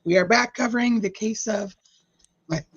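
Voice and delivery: croaky, needs to clear throat; frog in throat. Real, not acted. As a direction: voice catching in the throat. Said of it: "hold on, I got a frog in my throat".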